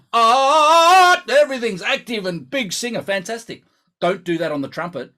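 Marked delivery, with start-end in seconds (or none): 0.1-3.6 high, loud theatrical singer voice; mock big singer. Mocking the West End singer's big sound, theatrical. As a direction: puff it up like a stage star. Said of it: "The singer who was on the West End".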